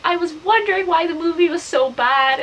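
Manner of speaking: high-pitched imitation of a duped brother; acted outrage, pitched high; mock indignation. Retells brother finding out he was tricked.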